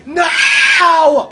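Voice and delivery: long, high, loud cry; dismayed shout. Real, not acted. Player falling off the platform, shouting in dismay. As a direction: yell it out as you fall.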